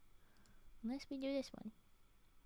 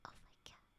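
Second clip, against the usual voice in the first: whisper; 21 dB quieter, faster than usual. Real, not acted. Awed by cuteness.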